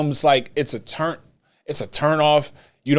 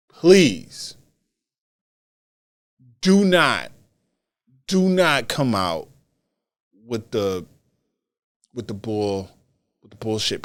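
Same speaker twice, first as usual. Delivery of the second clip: insistent plea, starting raised and easing down; pleading; 3 semitones lower than usual. Real, not acted. Podcaster begging Cody not to cut a promo, pleading. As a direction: implore him, pausing after each phrase. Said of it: "I'm pleading".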